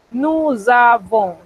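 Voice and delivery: slow sing-song Nous avons with a buzzing Z; rhythmic liaison. Shown as a demonstration. Teacher chanting nous avons slowly, rhythmic liaison. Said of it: "Es como sonora".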